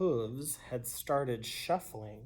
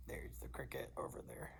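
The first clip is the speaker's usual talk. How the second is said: whisper; 12 semitones lower, 16 dB quieter, faster than usual. Real, not acted. Aside to children, pointing out.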